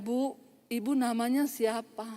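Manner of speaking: slow, soft, meek question; softened, polite asking. Retold or imitated. Relieved woman asking the preacher's name, softly and politely. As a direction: imitate someone gently asking, drawn out and deferential. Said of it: "Udah beda. Tadi kan".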